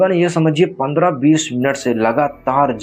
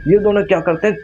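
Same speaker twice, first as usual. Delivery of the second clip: usual speaking voice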